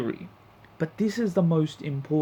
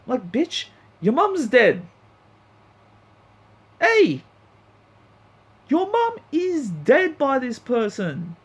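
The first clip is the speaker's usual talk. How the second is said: higher, incredulous scolding; exasperated rant; 9 semitones higher than usual. Real, not acted. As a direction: berate her, disbelieving. Ranting at Ruby.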